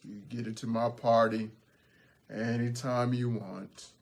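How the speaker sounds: low, soft, dejected concession; defeated troll. Acted. Beaten DJ Troll lets the goats into his party, dejected. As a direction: give in quietly, all energy gone. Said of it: "slumped his head and his shoulders".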